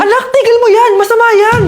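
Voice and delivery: high-pitched urgent cry to stop; alarmed warning. Acted. Mother stops child sweeping at night, alarmed. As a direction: cut in with superstitious alarm.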